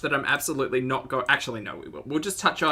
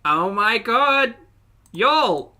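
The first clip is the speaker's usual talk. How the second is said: excited; 9 semitones higher, 7 dB louder, much slower than usual. Real, not acted. Reacting to Ambi's mukbang.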